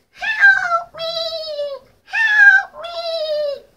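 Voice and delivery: squeaky high cry for help; tiny trapped fly shouting for help. Retold or imitated. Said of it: "I sound just like this, this fly".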